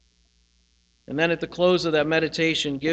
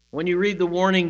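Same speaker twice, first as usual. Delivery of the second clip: usual speaking voice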